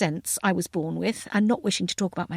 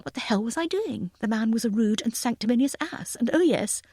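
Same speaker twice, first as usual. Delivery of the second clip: ordinary delivery, nothing marked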